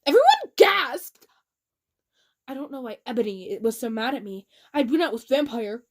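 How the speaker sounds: normal talking voice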